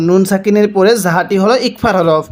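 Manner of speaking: usual speaking voice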